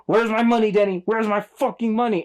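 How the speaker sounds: mock drug dealer yelling. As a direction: bark it like a hammy movie thug. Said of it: "And he's like".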